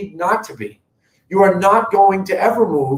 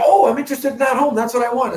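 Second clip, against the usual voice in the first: higher, quick imitation of an interested buyer; light mock excitement, pitched up and rushed; eager buyer; 7 semitones higher, faster than usual. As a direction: mimic a buyer who has just found the home. Voices an eager home buyer.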